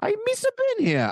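very high goofy alien voice; squeaky cartoon creature; Jar Jar Binks. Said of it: "Mister Jar Jar Binks".

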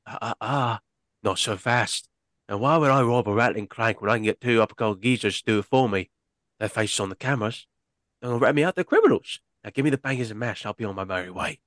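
put-on British gangster accent, cocky; convincing mock British accent; British crook accent. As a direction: play a smug British bank robber. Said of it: "I thought you were British there for a second".

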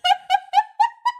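high burst of laughter. Real, not acted.

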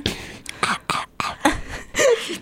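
unvoiced whispered sounds close to the mic; hushed ASMR sound test; whispery ASMR mouth noises. Shown as a demonstration. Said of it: "Give us some A S M R".

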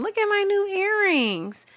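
high-pitched show-off mimicry; mock a poster flaunting in a squeaky voice; high singsong parody; attention seeker. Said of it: "Bitches say something".

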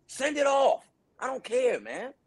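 high-pitched but no longer shouted, dropping off at the end; fed-up dismissal. Retold or imitated. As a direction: wave it away, fed up, fading out. Repeats it, giving up.

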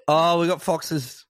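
ordinary delivery

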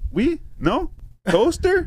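very high, separate questioning words; mock questioning. French girl testing an English stranger, high imitated questioning.

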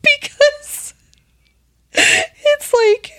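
high, squeaky laughing fit; cracking up while speaking; real laughter. Real, not acted. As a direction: struggle to talk through helpless laughter. Cracking up at long article.

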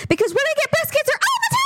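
high, mock-cheerful exclamation; sarcastic outburst. Friend cracking a dark joke about the doctor, high and mocking. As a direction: deliver it as a bitter joke, way up.

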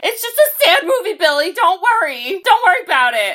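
high, tearful put-on reassurance; hammed-up weepy mom voice; mock crying mom. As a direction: play a mom brushing off her tears.

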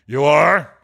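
loud, gruff mock-stern challenge; feigned growl. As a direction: throw it back at him, pretending to be cross. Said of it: "growled Scrooge in his accustomed voice".

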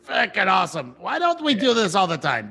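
a big wolf thrilled he can finally talk; excited dire wolf. Acted. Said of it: "And he goes".

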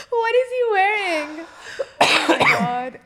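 high, loud, through laughter; laughing disbelief. Real, not acted. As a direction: exclaim it while cracking up. Reacting to photo, laughing.

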